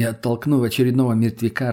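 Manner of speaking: usual speaking voice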